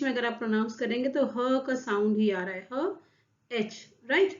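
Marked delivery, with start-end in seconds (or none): none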